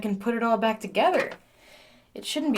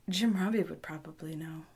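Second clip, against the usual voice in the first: soft unvoiced whisper aside; hushed whispered musing; 8 semitones lower, 7 dB quieter than usual. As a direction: whisper it to yourself. Quiet aside while exploring.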